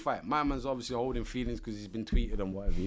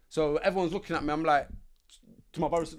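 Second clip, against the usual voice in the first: usual speaking voice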